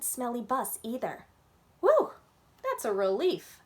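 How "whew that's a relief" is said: high whew, then louder relief; a kid's hammed-up whew of relief; big relieved whew. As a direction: sigh out the relief like a little girl. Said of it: "I said".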